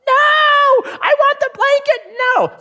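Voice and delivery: very high shrieking child imitation; hammed-up high-pitched kid scream; screaming tantrum. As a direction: mimic a toddler throwing a fit.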